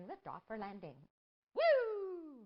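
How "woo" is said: excited whoop. Real, not acted. Excited at orange suit.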